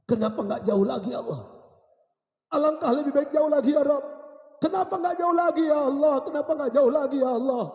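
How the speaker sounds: anguished, high loud wail to God; dying man's pleading lament; tearful cry. Acted. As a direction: build from a plea into a crying shout. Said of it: "Suamiku menangis".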